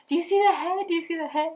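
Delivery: high, loud, eager repeated question; eager asking. Retold or imitated. Mother in labor asks the doctors, high and eager. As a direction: beg to know, all keyed up. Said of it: "I kept on asking".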